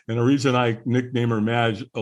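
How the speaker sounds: ordinary delivery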